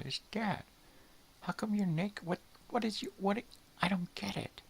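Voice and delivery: very high, squeaky puzzled dog voice; dog's voice. Retold or imitated. Dog stares at his naked owner, very high squeaky bewilderment. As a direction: play a baffled little dog, squeaky and high. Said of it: "your dog looks down and goes".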